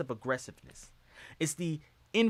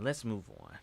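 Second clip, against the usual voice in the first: Let's move on dropped low and much softer; quieter repeat; 8 semitones lower, 9 dB quieter than usual. Real, not acted. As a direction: mutter it again to yourself. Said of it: "But let's move on".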